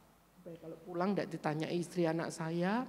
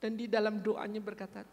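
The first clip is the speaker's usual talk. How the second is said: normal talking voice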